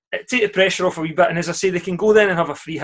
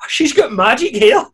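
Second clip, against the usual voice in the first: much higher, louder, slow amused outburst; giddy laughing disbelief; 8 semitones higher, 5 dB louder, slower than usual. Real, not acted. Ranting to the pod mates about the haircut, giddy and laughing. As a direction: stretch it out in delighted disbelief.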